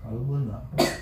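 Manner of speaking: low, subdued hush. Acted. Husband hushes angry wife again, low and soft. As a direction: drop the voice to quieten her.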